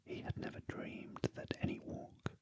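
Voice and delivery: ordinary delivery, nothing marked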